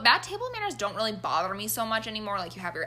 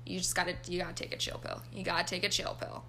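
self-calming; 3 semitones lower, 9 dB quieter than usual. Retold or imitated. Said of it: "talk myself down".

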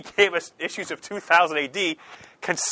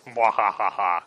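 mad scientist; 8 semitones lower, much slower than usual. Pretend. Evil laugh after plug.

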